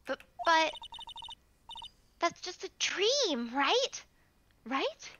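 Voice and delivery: unsure, rising plea; anxious pleading. Acted. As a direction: ask slowly for reassurance, pitch climbing. Game character doubting, pleading for assurance.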